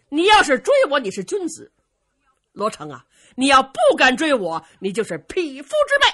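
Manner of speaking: loud, high-pitched dare; goading challenge. A narrator's reading. Dongfang Bo daring Luo Cheng to chase him, goading challenge. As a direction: taunt them into chasing you.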